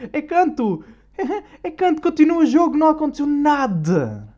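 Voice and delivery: high, loud, laughing mock call; dismissive players mocked while laughing. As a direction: squeal it out as if nothing happened. Mocks players shrugging off injury.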